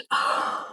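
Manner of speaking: sharp gasp. Real, not acted. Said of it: "I'm freaking out".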